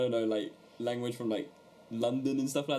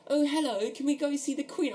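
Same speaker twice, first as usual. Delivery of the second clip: high, hammed-up British accent; exaggerated British accent, pitched right up; mock British accent; 13 semitones higher than usual. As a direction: imitate an American doing a twee British accent. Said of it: "a British accent".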